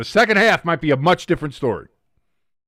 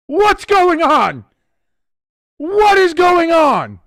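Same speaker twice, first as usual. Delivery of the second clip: much higher, louder disbelieving outburst; can't believe what just happened; stunned disbelief; 10 semitones higher, 5 dB louder than usual. Real, not acted. Repeated disbelief after touchdown.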